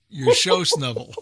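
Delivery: "show snovel" said with the sh and sn swapped, like a tongue slip; playful spoonerism. Shown as a demonstration. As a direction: repeat "your show snovel" as a deliberate tongue twist for laughs. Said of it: "say that again".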